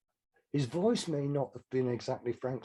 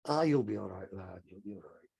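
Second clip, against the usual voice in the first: reassuring; 5 semitones lower than usual. Retold or imitated. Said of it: "I said".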